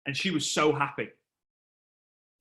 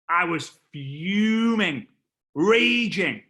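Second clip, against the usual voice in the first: each word louder and higher, fuming; building anger; 4 semitones higher, 4 dB louder, much slower than usual. Retold or imitated. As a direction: build from simmer to near shout.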